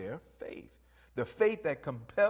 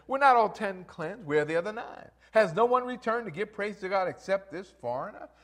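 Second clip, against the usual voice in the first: preached question; 6 semitones higher, 6 dB louder than usual. Acted. Jesus's words preached.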